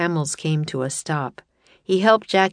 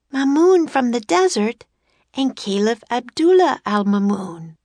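put-on high, louder excited girl; 7 semitones higher, 4 dB louder than usual. A narrator's reading. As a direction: voice Annie working it out. Annie voiced by narrator.

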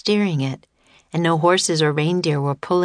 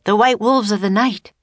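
dramatic, higher-pitched warning; urgent raised voice; alarmed cry; 4 semitones higher, 3 dB louder than usual. Acted. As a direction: announce the danger with alarm. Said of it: "cried Teddy".

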